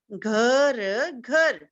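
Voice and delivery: घर very slowly as long घ, long र, then joined; say-along sounding out. Shown as a demonstration. Teacher asking children to repeat along, sounded out slowly. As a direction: stretch the sounds of घर, then blend them. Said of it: "मेरे साथ बोलिए".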